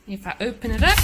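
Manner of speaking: ordinary talk, nothing marked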